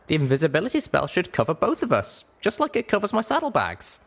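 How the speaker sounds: put-on high, eager young pony voice; small mare walking friends through a rescue; young Littlepip explaining. Littlepip lays out her plan.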